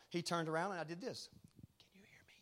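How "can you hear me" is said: barely audible, voiceless whisper; simple whisper. Retold or imitated. Preacher re-enacting testing a deaf man's hearing, simple whisper. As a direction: whisper it as a hearing test, no microphone. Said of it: "I'm gonna simply whisper".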